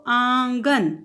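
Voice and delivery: आंगन very slow, nasal आ; slow nasal demo. Shown as a demonstration. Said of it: "चांद बिंदु सिर्फ कहाँ है".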